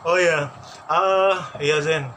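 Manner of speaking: ordinary delivery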